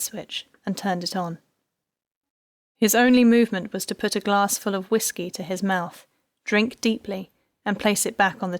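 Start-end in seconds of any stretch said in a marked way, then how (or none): none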